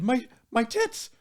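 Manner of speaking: high, slow amazed gasp; gasping delight. Retold or imitated. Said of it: "she gasped and she said".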